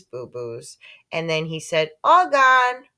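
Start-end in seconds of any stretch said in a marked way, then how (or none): 2.0-2.9 put-on high, loud toddler announcement. A narrator's reading. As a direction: say it like a small child. Toddler Fudge.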